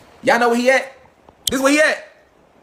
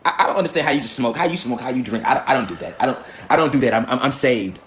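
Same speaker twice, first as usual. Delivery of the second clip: low, soft, prissy holier-than-thou voice; mock pious churchgoer; 9 semitones lower, 3 dB quieter than usual. Mocking a pious churchgoer with a low, prim voice. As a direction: imitate a smug saved churchgoer.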